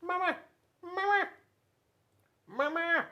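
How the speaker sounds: very high, chirpy gosling calling Mama; baby goose voice. Acted. Goslings calling after grumpy bear Bruce, high and chirpy. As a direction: pipe it up like a tiny gosling calling its mother. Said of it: "saying was".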